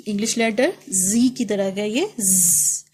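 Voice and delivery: ordinary talk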